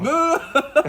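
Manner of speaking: loud laughing protest; protestation amusée. Real, not acted. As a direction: shout no, laughing it off. (non amusé et fort)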